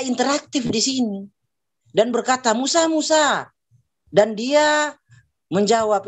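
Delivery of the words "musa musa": calling Musa much higher, drawn out; God calling out. Acted. God calling Moses from the burning bush, raised call.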